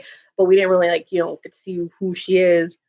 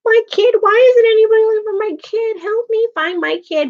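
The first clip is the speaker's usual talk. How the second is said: very high, mock-helpless wailing; shrieky mocking imitation of a desperate mom; whiny Carol; 13 semitones higher, 3 dB louder than usual. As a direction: wail like a helpless mother, hammed up. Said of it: "when she was always like".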